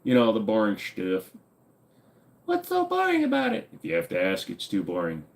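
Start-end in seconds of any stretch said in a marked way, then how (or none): 2.4-3.7 put-on high squeaky little kid voice. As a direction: play a whiny little kid.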